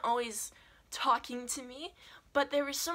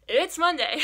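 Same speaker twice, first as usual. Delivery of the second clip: high, loud exclamation; alarmed exclamation; 6 semitones higher, 11 dB louder than usual. Retold or imitated. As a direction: burst out in mock alarm. Mock panic over Friday's deadline.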